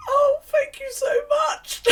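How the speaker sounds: high, drawn-out wailing thanks; hammed-up sobbing, loud and slow; mock tearful gratitude. Thanking a knitting student down the phone, mock tearful. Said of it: "didn't phone you to make you cry".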